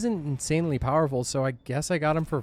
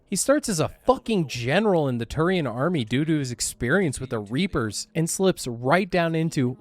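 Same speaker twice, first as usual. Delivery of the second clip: ordinary talk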